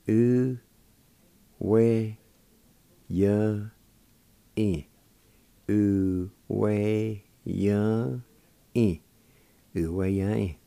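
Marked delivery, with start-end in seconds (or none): 0.0-4.8 U, we, ya, in, each held, long pauses between; syllable by syllable. Shown as a demonstration. Teacher sounding out the word for meander, slow and broken up. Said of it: "It's pronounced like this". 5.7-9.0 U, we, ya, in as separate held syllables; short gaps between U, we, ya and in. Shown as a demonstration. Teacher repeating the word for meander, syllable by syllable. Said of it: "It's pronounced like this".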